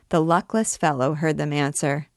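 ordinary delivery, nothing marked

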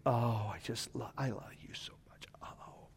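put-on soft whisper, cooing over a baby; whispering adoration. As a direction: breathe your love to the infant.

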